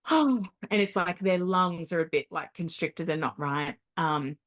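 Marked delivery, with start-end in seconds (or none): 0.1-0.4 high, loud "oh" of a sigh; demonstrated sigh. Shown as a demonstration. Showing how grieving patients sigh, demonstrated sigh. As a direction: let out a big illustrative sigh. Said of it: "they tend to sigh a lot, like, they'll go".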